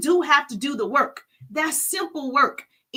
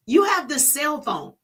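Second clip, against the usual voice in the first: normal talking voice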